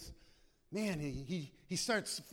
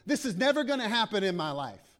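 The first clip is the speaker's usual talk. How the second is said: mock defiant complaint; 4 semitones higher, 8 dB louder than usual. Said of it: "He doesn't say".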